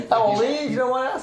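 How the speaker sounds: asked through a high laugh. Real, not acted. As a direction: giggle the question out quickly. Laughing while asking age.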